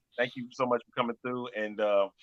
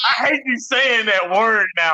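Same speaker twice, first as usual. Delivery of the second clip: high squeaky, louder complaint; 10 semitones higher, 9 dB louder than usual. Pretend. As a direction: whine it like a chipmunk. Keeps chipmunk voice going.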